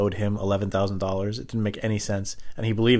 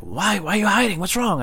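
much higher, mock concerned coworker; imitating the worried coworker; 11 semitones higher than usual. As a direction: play the coworker fretting over the kid, pitched up. Coworker worried about the kid.

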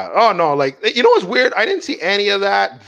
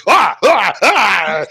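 mock pained cries; 4 semitones higher, 5 dB louder, much slower than usual. Retold hot oil spraying.

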